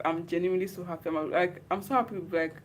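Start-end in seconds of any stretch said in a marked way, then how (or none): none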